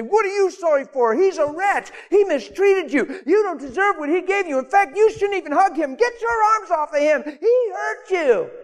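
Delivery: high-pitched mock outraged friends, stretched out at the end; indignant friends. Wife's friends objecting to her apology, indignant high mock scolding. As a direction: scold her in an exaggerated high voice, building to a cry. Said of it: "Her friends would say".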